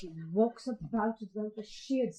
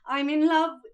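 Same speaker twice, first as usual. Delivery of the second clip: louder, higher declaration; confessing love to her sisters, louder; bursting confession; 4 semitones higher, 8 dB louder than usual. Acted. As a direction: blurt it out loud.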